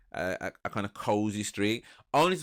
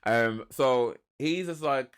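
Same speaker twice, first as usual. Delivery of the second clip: usual speaking voice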